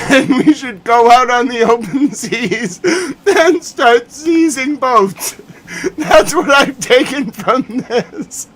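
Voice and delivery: high, wheezy laughing speech; helpless laughter stretching out the words; laughing hard. Real, not acted. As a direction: struggle to finish through laughter. Losing it over seizing boats.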